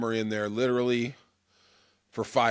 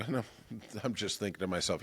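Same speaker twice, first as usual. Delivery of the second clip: ordinary talk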